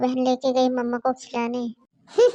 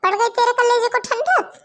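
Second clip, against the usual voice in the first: mock her bitterly, voice raised; furious taunting; 3 semitones higher, 4 dB louder than usual. Mother-in-law taunting her sick daughter-in-law, loud and sarcastic.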